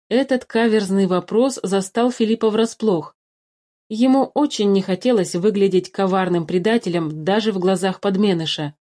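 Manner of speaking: plain reading voice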